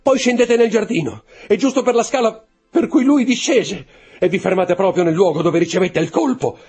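high, loud, agitated outpouring; distraught confession. A narrator's reading. Steward confessing the murder to the count, distraught. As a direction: pour it out as if hunted by fate.

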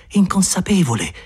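plain reading voice

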